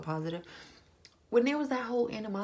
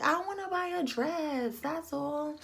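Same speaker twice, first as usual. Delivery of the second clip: much higher, mocking her innocence; mock innocent voice; 9 semitones higher, slower than usual. Mimicking Cynthia in the bridal store, high innocent. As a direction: play her as breezy and innocent. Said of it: "She was like".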